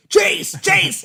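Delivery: loud high shout of Chase; yelling for son. Retold or imitated. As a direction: yell his name through the door.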